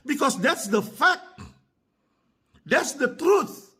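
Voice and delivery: slow, drawn-out stress on "fact" and "truth"; emphatic declaration. Real, not acted. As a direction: hammer home "fact" and "truth", stretching each out. Driving home a blunt truth. (penekanan lambat dan tegas pada "fact" dan "truth")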